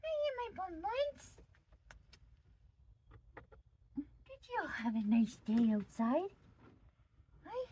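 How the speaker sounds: high, sing-song greeting to pets; high pet talk. Real, not acted. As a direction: coo a hello to the bunnies.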